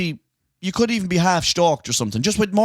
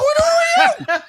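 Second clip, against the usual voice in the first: much higher, laughing mock shriek; squeaky laughing outburst; much slower than usual. Host laughing while retelling his shout at the Frenchman. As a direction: crack up mid-question. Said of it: "And I was like".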